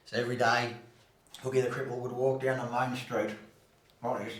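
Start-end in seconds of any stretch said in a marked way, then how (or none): none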